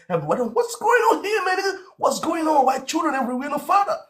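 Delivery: high shouted outrage; outraged rant. Acted. Ranting at the crowd about fatherless kids, shouting high. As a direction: yell it like a fed-up uncle.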